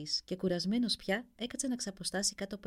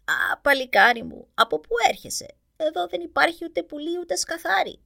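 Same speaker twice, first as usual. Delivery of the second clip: put-on old witch voice; 8 semitones higher, 9 dB louder than usual. A narrator's reading. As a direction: croak it out like a fairy-tale witch. Second witch greets. (τσιριχτή φωνή γριάς μάγισσας)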